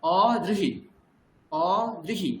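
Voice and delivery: "অদৃহি" as open o, then drihi; joined dri. Shown as a demonstration. Teacher models ri-kar for student, dri joined. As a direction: leave first vowel of "অদৃহি" open, fuse d with ri. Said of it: "দ এবং রি একসঙ্গে".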